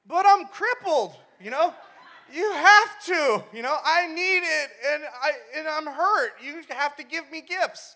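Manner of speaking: mock whining. Injured teen begging dad for a car, mock whining. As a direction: wail each plea like a hammed-up spoiled kid.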